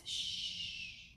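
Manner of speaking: soft, sustained shush; hushing. Acted. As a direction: shush them softly. Penguin hushes the seal.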